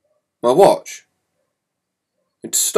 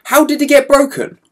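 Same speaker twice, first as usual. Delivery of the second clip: stern dad; 12 semitones higher, 5 dB louder than usual. Retold or imitated. Angry dad questioning.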